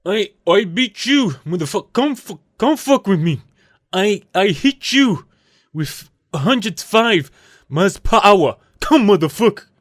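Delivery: high-pitched mock trash talk; Aroldis Chapman. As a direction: imitate a pitcher talking smack, stuttering threats. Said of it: "Chapman's gonna be like".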